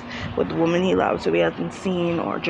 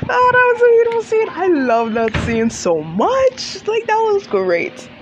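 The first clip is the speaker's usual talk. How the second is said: gushing; 13 semitones higher, 3 dB louder than usual. Real, not acted. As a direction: swoon over a favourite scene. Gushing over favourite scene.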